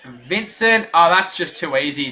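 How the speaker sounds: ordinary talk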